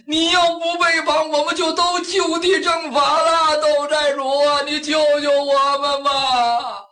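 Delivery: high, long drawn-out wail of a crowd; full-throated pleading shout. A narrator's reading. Captured bandits beg their chief to surrender, yelling at full stretch. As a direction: stretch every phrase, yelling high for mercy. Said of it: "扯着嗓子".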